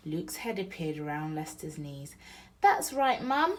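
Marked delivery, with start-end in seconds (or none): 2.6-3.6 put-on high, eager little boy chiming in; agreeing child. Little brother agreeing with Mum, high and eager. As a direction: back up your brother, keen and high. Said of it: "he agreed".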